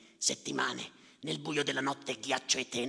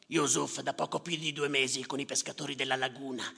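normal talking voice

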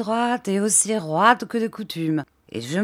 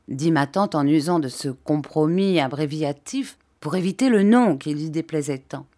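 usual speaking voice

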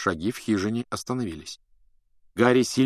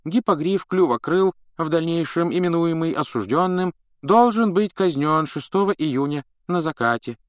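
higher official voice droning on; monotone droning; 9 semitones higher than usual. A narrator's reading. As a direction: drone it like a bored official. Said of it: "монотонно бубнил Фадж".

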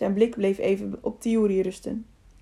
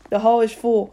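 firm, somewhat louder call; 5 dB louder than usual. A narrator's reading. Man beside the mayor answers the crowd, firmly called out. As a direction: call it out over the crowd, restrained. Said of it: "riep de man".

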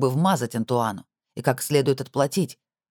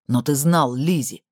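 restrained narrated shout, drawn out; understated cry of accusation; 3 dB louder, slower than usual. A narrator's reading. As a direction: accuse him with a mild raised voice. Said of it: "закричал Харди".

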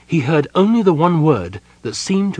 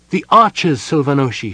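raised, higher call to the fallen king; urgent cry; 3 semitones higher, 4 dB louder than usual. Acted. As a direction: cry out to rouse him. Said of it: "she cried".

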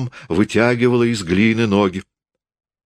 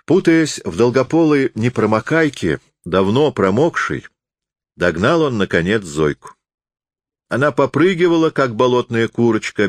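ordinary delivery, nothing marked

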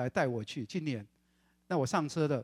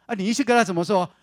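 mother snapping back, much louder; mock shout; 5 semitones higher, 13 dB louder than usual. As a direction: throw the question back loudly, higher. 母亲提高声音回话. (妈妈不耐烦地大声回嘴)

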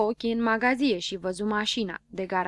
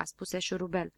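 plain reading voice

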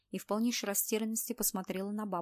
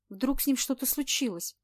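angry, louder and higher; 4 semitones higher, 5 dB louder than usual. A narrator's reading. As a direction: snap it out angrily. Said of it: "зло ответила Анна".

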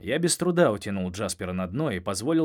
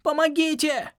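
scared squeaky cry; 7 dB louder than usual. Acted. Trapped Jasper cries for help.

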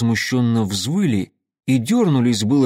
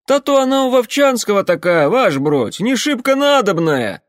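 very high, loud jeering; mock him like a rowdy trooper; mocking soldier voice; 10 semitones higher, 6 dB louder than usual. Werewolves mocking the sergeant.

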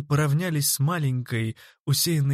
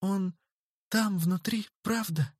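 tearful pleading; 7 semitones higher, 4 dB quieter than usual. A narrator's reading. Angela pleads, then sobs.

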